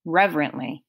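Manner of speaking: usual speaking voice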